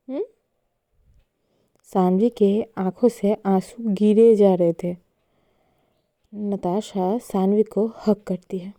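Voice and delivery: ordinary delivery, nothing marked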